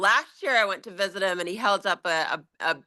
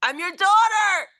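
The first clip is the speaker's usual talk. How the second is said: put-on high, loud New York accent; 12 semitones higher, 5 dB louder than usual. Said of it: "in a New York accent".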